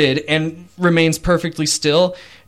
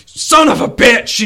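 much higher, much louder furious shout; enraged curse; 10 semitones higher, 10 dB louder than usual. Acted. Captain to the mocking prisoner, furious shout. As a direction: curse him out in rage.

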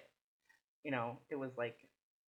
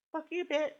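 mock retort; 14 semitones higher, 5 dB louder than usual. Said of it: "I'm like".

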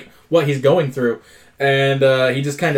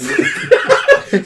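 amused squeal of a laugh; high laugh. Real, not acted. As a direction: burst of high-pitched laughter. Laughing at the quote.

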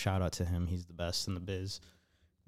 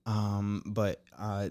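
normal talking voice

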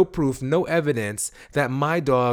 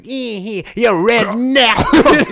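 put-on high, slow, louder Jamaican accent jeer; mock Jamaican lady; 9 semitones higher, 9 dB louder, much slower than usual. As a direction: stretch out the Jamaican taunt. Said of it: "She was like".

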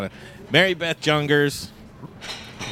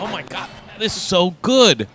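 savoring delicious food; 7 semitones higher, 4 dB louder than usual. Real, not acted. Tasting the kombucha.

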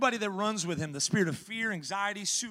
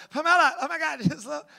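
panicked yell; 8 semitones higher, 7 dB louder than usual. Retold or imitated. Terrified passenger yelling at driver.

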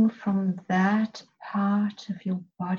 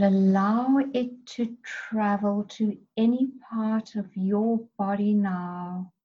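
usual speaking voice

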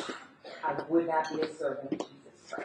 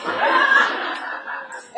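high unvoiced burst of laughter; breathy laugh at her own story; 7 dB louder than usual. Real, not acted. Speaker laughs retelling the bath story, airy burst.

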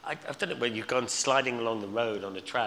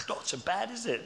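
higher, breezy cheery tone; cheery reply; 11 semitones higher than usual. Retold or imitated. His cheery reply in hospital.

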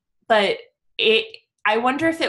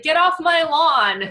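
put-on grumpy old man; 6 semitones higher, 5 dB louder, slower than usual. Grumpy old man yelling at kids.

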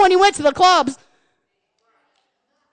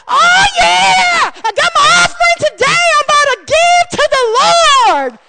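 much higher, louder, shouting joy; joyful giver; 11 semitones higher, 6 dB louder, slower than usual. Pretend. Churchgoer bringing the offering, shouting with joy. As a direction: shout it like you can't wait to give. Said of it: "we should be like".

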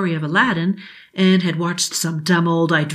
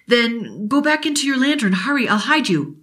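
put-on higher, hurried boy voice; urgent boy; 6 semitones higher than usual. Billy hiding the genie from his father, higher and hurried. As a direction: rush her into hiding.

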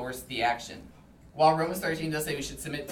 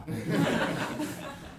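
short laugh; 2 semitones higher than usual. Real, not acted. Laughs at the dean's advice.

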